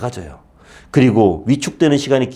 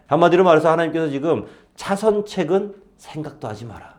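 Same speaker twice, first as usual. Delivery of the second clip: usual speaking voice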